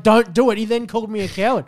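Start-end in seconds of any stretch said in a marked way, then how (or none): 0.0-0.6 urgent plea. Retold or imitated. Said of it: "was like".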